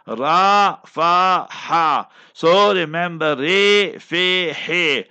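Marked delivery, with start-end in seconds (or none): 0.1-2.1 "Ra, fa, ha" as separate, drawn-out Arabic letters; letter-by-letter pronunciation. Shown as a demonstration. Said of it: "that is how you pronounce it". 3.4-5.0 "re, fe, he" as separate, held letter names; letter-by-letter pronunciation. Shown as a demonstration. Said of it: "that is how you pronounce it".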